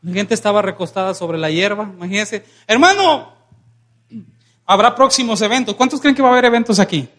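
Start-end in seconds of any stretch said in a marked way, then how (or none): 2.7-3.3 high, raised hail; calling out. Acted. Preacher hails a church brother about coming events, high calling voice. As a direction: sing out to someone across the room.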